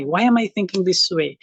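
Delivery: normal talking voice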